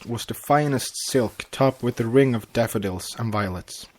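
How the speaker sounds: plain reading voice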